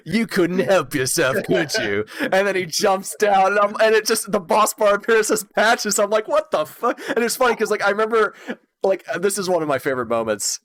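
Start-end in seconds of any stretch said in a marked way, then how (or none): 0.1-2.1 higher, slow and sly villain voice; sly Patches voice. Retold or imitated. Patches taunting the player who opened his chest, sly and higher. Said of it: "he's like". 6.3-7.0 high outraged disbelief; shocked what the fuck. Retold or imitated. Player seeing the boss bar read Patches, high and shocked.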